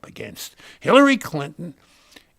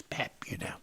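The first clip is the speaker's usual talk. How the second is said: hushed, breathy-free whisper of a rock star; 8 semitones lower, 17 dB quieter, faster than usual. Retold or imitated. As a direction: whisper it like a burned-out rocker. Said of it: "And he says".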